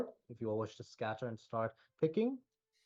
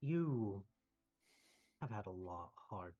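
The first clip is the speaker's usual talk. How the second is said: calm kindly mentor, reassuring; gentle wise healer; 3 semitones lower than usual. Acted. NPC speaks gently, removing curse.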